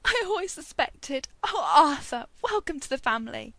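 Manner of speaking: high, loud, overcome welcome; tearful joy. A narrator's reading. Mum welcoming Arthur to the family, tearful. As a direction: burst out as if through happy tears. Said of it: "and then burst into tears".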